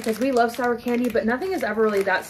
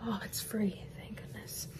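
relieved whisper; 12 dB quieter than usual. Real, not acted. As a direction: breathe it out softly. Hair elastics pulled out, relief.

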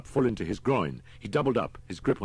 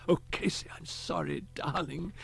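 higher, soft and tender apology; softly; 10 semitones higher than usual. A narrator's reading. Holman comforts Casey in his arms, tender and soft. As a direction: cradle her and murmur it gently. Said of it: "he said softly".

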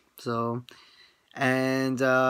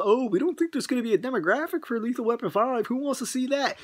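mocking the lawyers; 10 semitones higher than usual. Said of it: "the lawyers are saying this".

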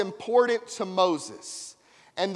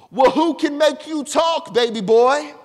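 louder, higher, teasing God voice; playful God voice; 5 semitones higher, 9 dB louder than usual. Retold or imitated. God teasing Moses as baby boy, loud and playful. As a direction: tease him lovingly like a father. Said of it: "God answers Moses playfully".

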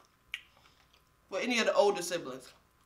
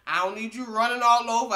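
ordinary talk, nothing marked

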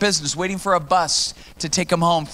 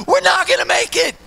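panicked shout; 4 dB louder than usual. Retold or imitated. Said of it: "I go".

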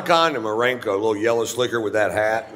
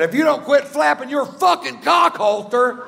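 high, strained mock yell; a lightly hammed-up yell at the chatty woman; retold yell. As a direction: yell it back at her across the row. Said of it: "I said".

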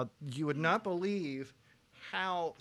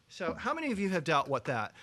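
usual speaking voice

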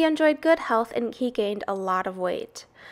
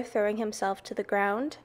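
plain reading voice

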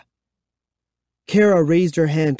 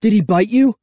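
high, alarmed question; anxious high voice; choked out; 7 semitones higher, 4 dB louder, faster than usual. A narrator's reading. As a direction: ask urgently, pitched up. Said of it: "I choked out".

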